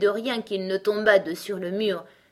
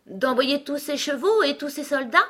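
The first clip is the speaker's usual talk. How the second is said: put-on high, louder eager little-girl voice; rash interruption; 8 semitones higher, 5 dB louder than usual. A narrator's reading. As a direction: jump in to finish his sentence. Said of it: "interrompit Alice assez imprudemment". (interruption imprudente et enjouée)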